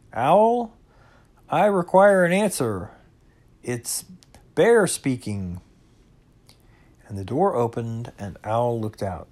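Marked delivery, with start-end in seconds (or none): 0.1-5.6 loud, high opening call, easing off. A narrator's reading. Pooh calling at Owl's door, loud voice. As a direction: call out firmly, demanding an answer. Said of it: "in a very loud voice".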